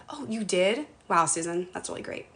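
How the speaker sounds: a hint of a flat, unimpressed husband, fading to a breath; lightly voiced husband, quiet and unmoved, dropping to a whisper at the end; husband's slow reply. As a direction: mildly imitate his delayed, deadpan answer. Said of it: "This is him".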